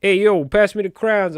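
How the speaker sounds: put-on New York "hey, yo"; New Yorker accent. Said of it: "I'm a New Yorker".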